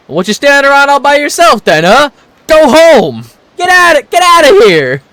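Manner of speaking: put-on loud gruff yell at a kid; angry yelling. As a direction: bark at the child to go home. Kiryu yells at lost child.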